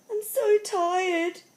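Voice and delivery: high, slow, drawn-out weary voice; exhausted girl. A narrator's reading. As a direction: play a worn-out girl calling to the sky. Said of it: "she said to the clouds and the wind".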